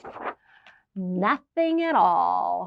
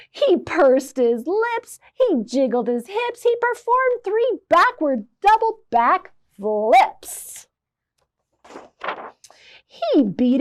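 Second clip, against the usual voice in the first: excited; 6 semitones higher, 5 dB louder than usual. A narrator's reading. Wild dance described.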